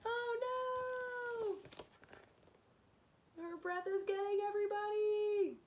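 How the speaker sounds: high, squealing crowd panic; alarmed cry. Acted. Carnival riders squealing in a high panicked voice. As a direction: shriek it like a scared rider.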